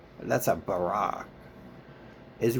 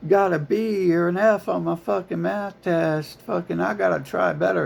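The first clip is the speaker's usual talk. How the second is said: mocking upset student; 9 semitones higher, 4 dB louder than usual. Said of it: "it's never like".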